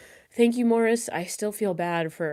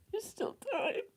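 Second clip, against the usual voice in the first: higher, grief-stricken voice; real tearful voice; 7 semitones higher than usual. Real, not acted. Streamer telling viewers about a lost brother, tearful. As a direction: talk through real tears. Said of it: "I couldn't stop crying".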